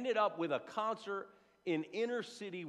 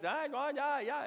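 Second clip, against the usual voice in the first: mock caller chatter; 11 semitones higher than usual. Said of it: "I got to call the Chinese".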